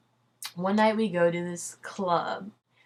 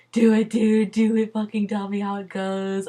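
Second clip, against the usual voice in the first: louder, eager "do it, dude"; friend's excited hype; excited cheering; 3 semitones higher, 6 dB louder than usual. Retold or imitated. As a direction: pump her up to go for it. Friend cheering her on by text.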